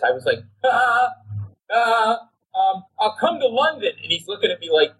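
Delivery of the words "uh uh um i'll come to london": much higher, flustered stammer; a starstruck fan whose voice jumps up; nervous changed voice. Retold or imitated. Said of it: "my voice literally changed to this".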